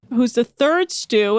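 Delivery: ordinary talk, nothing marked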